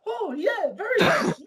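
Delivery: mock boss voice. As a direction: ham up the employer. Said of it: "he's like".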